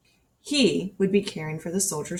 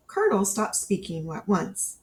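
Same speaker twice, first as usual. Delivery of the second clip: ordinary delivery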